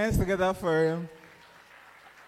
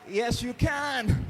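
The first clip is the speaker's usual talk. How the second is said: high, loud cheer; excited cheer; 9 semitones higher, 4 dB louder, much slower than usual. Real, not acted. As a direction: hype them up. Hyping up a contestant.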